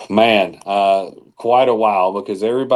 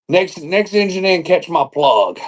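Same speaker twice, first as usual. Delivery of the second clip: higher, showy dispatcher call; mock radio call; 8 semitones higher than usual. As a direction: ham up a cute radio call. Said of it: "they'll try to be funny".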